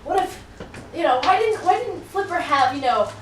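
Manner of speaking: high, bright stand-up delivery; comedian bit. Retold or imitated. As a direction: sell it like a stand-up joke. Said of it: "one of my favorite comedians was like".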